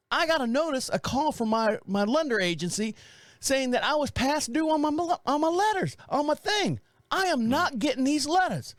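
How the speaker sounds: hammed-up, aggrieved old neighbor; a lightly voiced fretting old man; upset old neighbor. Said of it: "Now, he said".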